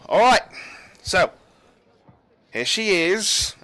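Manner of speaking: loud, high announcement; captain announcing. Acted. Captain presenting the senator to the guards, loud and high. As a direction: present her with ceremony.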